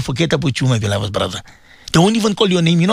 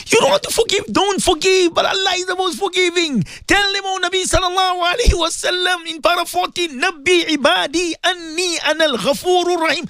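raised, high-pitched preacher's call; impassioned pulpit preaching, pitched high; fervent preaching; 9 semitones higher than usual. Acted. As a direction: urge the whole congregation to forgive. Preaching on forgiveness at full force.

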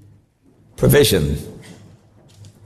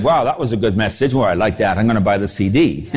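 lower, casual churchgoer praising the sermon; mock approving listener; 8 semitones lower than usual. Preacher mocking imagined pleased listeners, lower and casual. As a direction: play a bland satisfied listener, voice dropped. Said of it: "Do you think they said".

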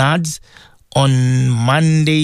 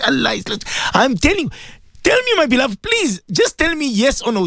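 high, urgent pleading; preacher's voice climbing high; impassioned preaching; 10 semitones higher than usual. Acted. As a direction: press the listener for an answer. Pleads as beloved, higher.

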